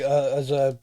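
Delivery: ordinary delivery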